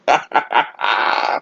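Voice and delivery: short bursts of laughter, then a longer tail; staccato chuckle. Real, not acted. Vlogger recalling a comedy movie's cursing character, amused chuckle. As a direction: chuckle in quick separate pulses.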